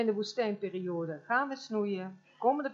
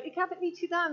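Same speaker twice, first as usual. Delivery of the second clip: higher-pitched protest of innocence; indignant denial; 9 semitones higher than usual. Acted. Wrongly blamed person to accuser, indignant denial.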